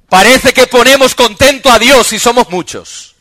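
loud, high preaching, every phrase driven hard; full-force preaching. Real, not acted. Pastor preaching to the congregation, loud and high. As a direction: preach it out to the whole church.

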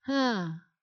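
usual speaking voice

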